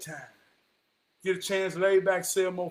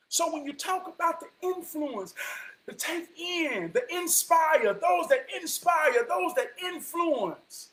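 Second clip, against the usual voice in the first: high, drawn-out preacher's cadence; building preaching; 9 semitones higher than usual. Acted. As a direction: slowly stretch each phrase, building up to the climax.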